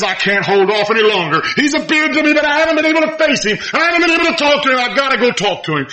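high, fast, urgent Peter; eager Peter. Acted. Preacher voicing Peter deciding to swim to Jesus, urgent. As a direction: rush it like he must go now. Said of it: "And he says".

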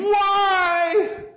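high exasperated shout; a frustrated cry at God; angry why. Retold or imitated. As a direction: yell it up at the heavens. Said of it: "I find myself saying".

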